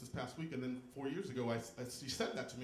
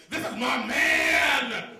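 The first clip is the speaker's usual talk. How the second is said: shrieking high, loud and slow at first; indignant shout; 13 semitones higher, 15 dB louder, slower than usual. Retold or imitated. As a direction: claim your man at full volume. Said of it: "we get indignant".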